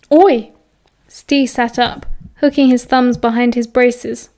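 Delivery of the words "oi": sharp call out. A narrator's reading. Older boy warns Remus off.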